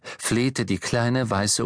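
ordinary delivery

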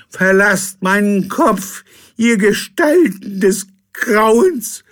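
high, loud, slow cry; captain's outburst. Acted. Drunk captain screams at the ghosts in his head. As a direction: banish them at the top of your voice.

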